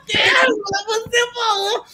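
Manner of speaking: very high mock cry of disbelief; esposa histérica. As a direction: imitate a hysterical wife accusing her husband. Wife insisting hysterically on pérgola. (imitação aguda de esposa incrédula)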